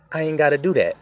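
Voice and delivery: ordinary talk, nothing marked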